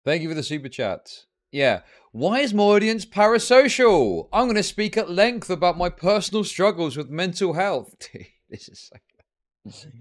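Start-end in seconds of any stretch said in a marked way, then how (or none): 2.1-7.9 louder, higher sarcastic voice; mocking parody. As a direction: sneer it in a high mocking tone.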